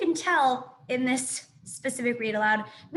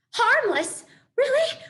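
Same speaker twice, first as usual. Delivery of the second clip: incredulous; 12 semitones higher, 7 dB louder, slower than usual. Acted. Opossum echoes in disbelief.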